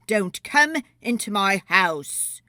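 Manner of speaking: loud, angry shout; shouted warning. Acted. Polecat shouting at the intruders to leave. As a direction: yell him off your doorstep. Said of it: "shouted Polecat".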